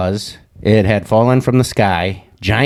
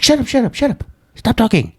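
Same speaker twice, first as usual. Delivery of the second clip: high, rapid-fire mock panic; imitating panicked caller; 14 semitones higher than usual. Caller silencing him on the phone, high mock panic. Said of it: "She's like".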